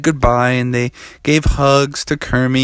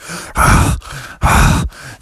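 exhausted gasps; 4 semitones higher than usual. Acted. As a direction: gasp as if danced out. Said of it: "then they all were like".

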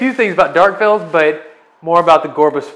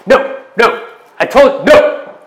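angry yelling madman; 12 semitones higher, 6 dB louder than usual. Acted. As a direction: shout it out high and furious. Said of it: "and he's like".

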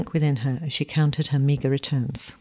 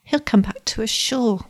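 put-on high, plaintive child's voice; little boy's high-pitched comforting; whimpered; 6 semitones higher than usual. A narrator's reading. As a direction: reassure in a small whimper. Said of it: "whimpered the little man".

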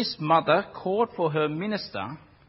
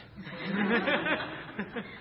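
laugh; 9 semitones higher, 4 dB quieter than usual. Real, not acted. Laughs at own joke.

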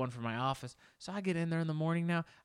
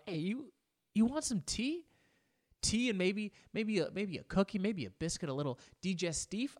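higher-pitched, casual hospitable offer; host offering tea; 7 semitones higher than usual. Pretend. Host to visiting friend, offering tea and treats, higher-pitched. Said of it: "and you go".

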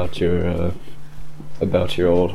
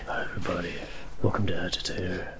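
soft, hushed public radio host; lightly voiced NPR announcer; 10 dB quieter, faster than usual. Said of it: "like, an N P R kind of thing".

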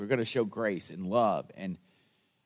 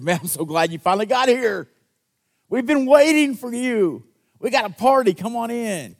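loud, high, delighted greeting; joyful welcome; 11 semitones higher, 8 dB louder than usual. Retold or imitated. Welcoming church greeting a newcomer, loud and overjoyed. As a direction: throw open the doors with joy. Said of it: "When the church has said".

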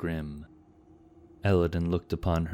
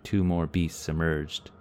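plain reading voice

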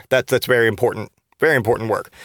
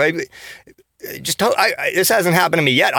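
usual speaking voice

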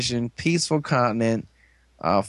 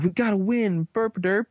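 dumb hero mockery; 8 semitones higher than usual. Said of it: "they're like".

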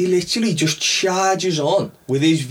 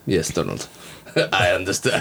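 smooth Cohn reassurance; 10 semitones lower than usual. Retold or imitated. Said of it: "I love how he approaches him".

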